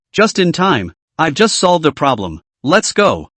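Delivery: usual speaking voice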